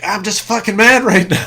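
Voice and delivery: loud, high hammed-up fury; mock angry shout. Telling Abby he's mad, loud mock outburst. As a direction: blow up in mock rage.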